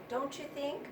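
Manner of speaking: normal talking voice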